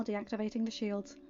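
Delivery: usual speaking voice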